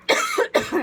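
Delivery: loud, sharp cough; real cough. Real, not acted. Reader interrupted again, coughing loudly. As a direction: cough hard, briefly.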